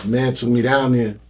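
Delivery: ordinary talk, nothing marked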